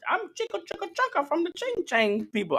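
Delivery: badly faked high-pitched Chinese accent; horrible Chinese accent. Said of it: "that's a horrible Chinese accent".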